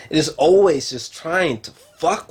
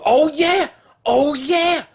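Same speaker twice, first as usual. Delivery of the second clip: oh yeah, leaping high; high excited recall; sudden remembering; 11 semitones higher, slower than usual. Real, not acted. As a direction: jump up as it comes back to you.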